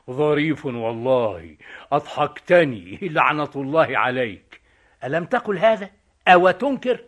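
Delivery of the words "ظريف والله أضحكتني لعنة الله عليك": quoting his accuser. Retold or imitated. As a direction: throw his own words back at him. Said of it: "ألم تقل لي". (يقلد كلام خصمه)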